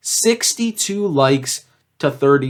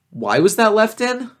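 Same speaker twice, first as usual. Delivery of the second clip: exasperated; 9 semitones higher than usual. Real, not acted. Reviewing his own old video.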